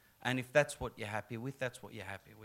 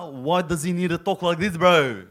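loud, exaggerated street-bro accent; put-on bro accent; 4 semitones higher, 10 dB louder than usual. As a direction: ham up a thick bro accent for comic protest. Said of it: "can you have him talk with an accent".

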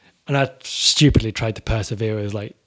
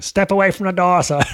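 stern officer; 8 semitones higher, 5 dB louder than usual. Retold or imitated. Officer ordering the friend.

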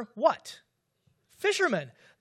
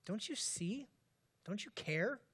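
much softer, intimate pleading whisper-like; hushed plea; 10 dB quieter than usual. Retold or imitated. Preacher voicing a hurting believer's prayer, hushed plea. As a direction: murmur it like a private prayer.

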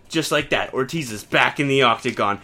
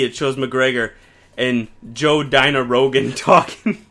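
usual speaking voice

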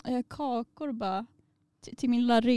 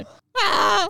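high, loud stressed yelp; mock panicked scream; 10 semitones higher, 7 dB louder than usual. Friend hearing declarations of love, mock stressed scream. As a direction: yelp as if overwhelmed, played for laughs. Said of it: "jag får ju typ stress".

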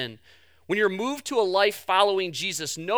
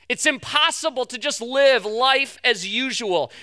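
louder, higher, slow fervent preaching; preaching at full force; 6 semitones higher, 5 dB louder, slower than usual. Acted. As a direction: build to a passionate appeal. Preaching climax.

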